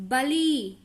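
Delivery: "बली" with a held final ee; long vowel. Shown as a demonstration. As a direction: draw out the ending of "बली". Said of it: "ई देर तक बोला हमने".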